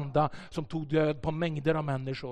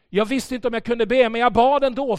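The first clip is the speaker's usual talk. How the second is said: louder and higher, building; rising intensity; 6 semitones higher, 8 dB louder than usual. Retold or imitated. Preacher retelling the pastor's trembling prayer, building louder. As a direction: build it up with urgency. Shaken pastor before praying.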